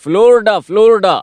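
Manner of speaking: high, excited burst of remembering; sudden eureka, pitched high; excited recall. Acted. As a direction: blurt it out as it comes back to you.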